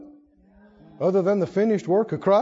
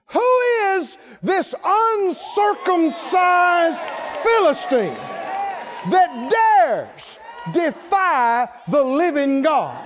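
booming challenge; 6 semitones higher, much slower than usual. Acted. David defies the giant before the army, shouting. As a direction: thunder it out like young David defying the giant.

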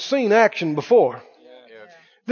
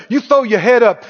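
ordinary talk, nothing marked

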